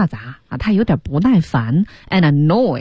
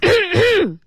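mock throat clearing; 13 semitones higher than usual. Shown as a demonstration.

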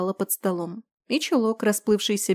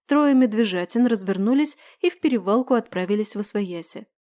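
ordinary delivery, nothing marked

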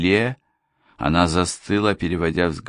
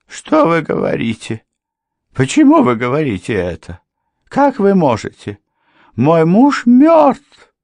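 rising higher and louder, helpless panic; helpless babbling; 11 semitones higher, 8 dB louder than usual. A narrator's reading. Housekeeper hearing her husband lives, helpless and rising panic. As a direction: lose your footing more each phrase. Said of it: "беспомощно пролепетала она".

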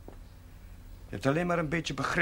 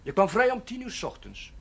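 raised, sharp reproach; exasperated scolding; 7 semitones higher, 5 dB louder than usual. Acted. Priest scolding René, louder and higher in exasperation. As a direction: let the frustration spill out.

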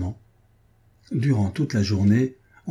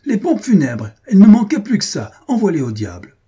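bursts out shouting, far higher and louder; furious outburst; 9 semitones higher, 8 dB louder than usual. Acted. Painter shouting at the servant over the undertakers, furious. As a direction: explode at the news like a man pushed past his limit. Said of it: "Barnès éclata".

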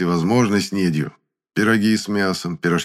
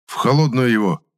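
mildly hoarse, raised-pitch command; hoarse order; 5 semitones higher, 4 dB louder than usual. A narrator's reading. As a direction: croak out the order after being choked. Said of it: "прохрипел Гудков".

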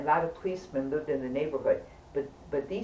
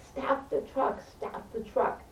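high, drawn-out cry without the volume; mock scream, stretched slow and held back; retold scream; 7 semitones higher, 4 dB quieter, slower than usual. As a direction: plead it high and slow, half-voiced. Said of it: "I'm screaming".

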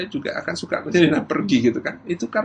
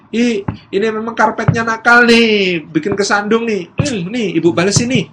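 mother blaming the carpet; 7 semitones higher, 5 dB louder than usual. Acted. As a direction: play-act telling off a naughty carpet for the child. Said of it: "Itu juga blaming". (pura-pura memarahi karpet dengan nada tinggi)

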